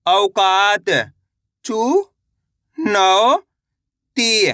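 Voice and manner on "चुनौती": चुनौती stretched very slowly, syllable by syllable; teacher spelling चुनौती aloud; sounded out slowly. Shown as a demonstration. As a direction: drag चुनौती out piece by piece. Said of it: "तो मिलके बन जाएगा".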